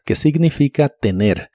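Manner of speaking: ordinary talk, nothing marked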